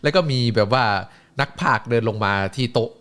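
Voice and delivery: ordinary talk, nothing marked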